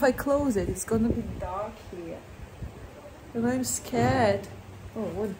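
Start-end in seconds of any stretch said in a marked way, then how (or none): none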